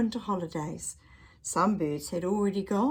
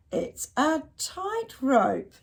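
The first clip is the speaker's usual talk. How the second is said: slow explaining; 7 semitones higher, much slower than usual. Acted. Squirrel explains tightrope.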